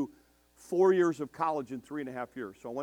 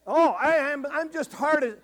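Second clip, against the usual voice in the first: mock whiny girl; 13 semitones higher, 8 dB louder, slower than usual. Granddaughter whining.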